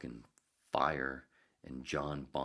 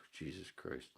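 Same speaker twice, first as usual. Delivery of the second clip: normal talking voice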